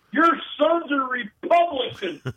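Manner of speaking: high, slow mock announcement of a scandal; outraged dad.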